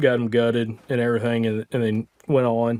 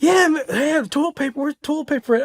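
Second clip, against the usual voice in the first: high-pitched mocking imitation of buddy; dumb act; 13 semitones higher than usual. Imitating his buddy asking about toilet paper, dumb act. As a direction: mimic a clueless question, pitched way up. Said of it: "it was so s-, dumb".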